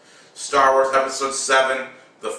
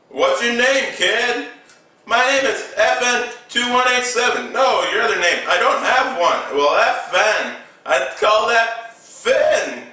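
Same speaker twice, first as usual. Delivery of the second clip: higher-pitched acted exchange; animated mock dialogue; 8 semitones higher than usual. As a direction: play both characters in a raised, lively pitch. Acts out film dialogue between two characters.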